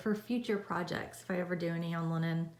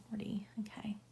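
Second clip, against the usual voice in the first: whispering; 10 dB quieter than usual. Real, not acted. Whispered to self after fumbling.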